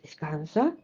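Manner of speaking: low, soft soothing word; calm the listener gently; soft reassurance. Acted. God's soothing reply.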